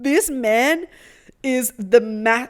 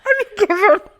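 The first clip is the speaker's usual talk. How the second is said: mock girlfriend squeal; 13 semitones higher, 8 dB louder than usual. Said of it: "mocking the girlfriend quote".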